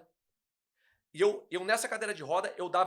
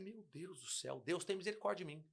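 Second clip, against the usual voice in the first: hushed desperate prayer; 11 dB quieter than usual. Retold or imitated. Retold desperate prayer to God.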